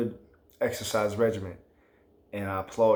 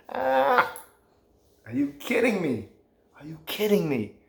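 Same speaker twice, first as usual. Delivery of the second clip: incredulous; 8 semitones higher, 3 dB louder than usual. Real, not acted. Incredulous rant at listeners.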